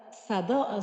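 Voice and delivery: usual speaking voice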